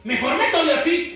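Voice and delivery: high, indignant imitation of an onlooker; hammed-up self-justifying complaint; mock critic. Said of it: "un cristiano que se equivoca y dice".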